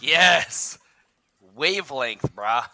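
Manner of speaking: loud high cheer; excited cheer. Real, not acted. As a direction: whoop at the win. Celebrating a good roll.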